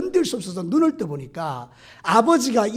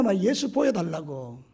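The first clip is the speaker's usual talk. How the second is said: ordinary talk, nothing marked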